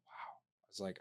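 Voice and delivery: ordinary talk, nothing marked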